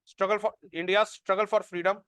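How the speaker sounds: slow, spaced dictation with long pauses. Acted. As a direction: dictate each phrase slowly so students can write it down. Dictating notes to students. (धीमी आवाज़ में notes लिखवाना)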